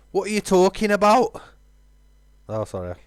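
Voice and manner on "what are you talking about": high, loud line where the voice gives out; voice cracking. Acted. Shouting at Evelyn, voice cracking. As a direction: let the voice crack on the yell. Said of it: "went in me, voice".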